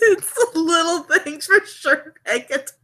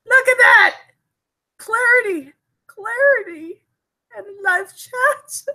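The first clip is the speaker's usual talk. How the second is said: high, delighted exclamations; thrilled; 7 semitones higher, 4 dB louder than usual. Real, not acted. Streamer admires the camera's clarity, thrilled. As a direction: marvel at it, voice leaping up.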